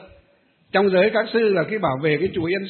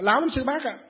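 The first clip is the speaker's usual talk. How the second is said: indignant complaint; 7 semitones higher than usual. Retold or imitated. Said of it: "nói".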